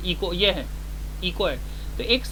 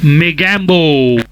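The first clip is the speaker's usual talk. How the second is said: slow booming villain yell; drawn-out loud villain call; Mogambo shout; 18 dB louder, much slower than usual. Shown as a demonstration. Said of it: "तो ये मेरा sound मैंने एक और record किया".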